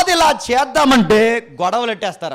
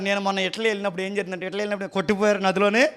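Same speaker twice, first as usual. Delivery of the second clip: fast, softer chatter mimicking a pastor's wife; quick mock boasting anecdote; 4 semitones lower, 8 dB quieter, much faster than usual. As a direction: rattle off her travel story, mockingly. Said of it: "pastor అమ్మ గారు".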